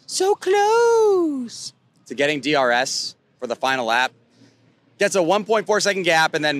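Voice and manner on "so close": high, slow and drawn out; so close pulled long and high; excited stretched emphasis. Real, not acted. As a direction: stretch it out, agonizing over the near miss. Tense final lap.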